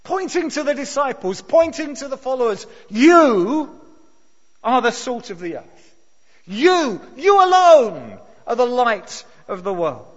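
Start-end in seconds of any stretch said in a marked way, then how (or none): 2.9-10.1 YOU punched loud, then a ringing declaration; emphatic preaching. Shown as a demonstration. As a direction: proclaim it like a call to the whole hillside. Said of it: "the same finger-pointing word".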